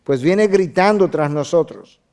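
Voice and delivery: loud, pressing appeal; urgent pleading. Retold or imitated. Disciples begging Jesus to answer the woman, loud plea. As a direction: urge him to deal with her. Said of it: "le rogaban".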